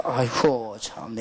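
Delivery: low, drawn-out exasperated sigh; weary sigh of dismay. Retold or imitated. Preacher recalling his dismay at the cursing pastor, weary sigh.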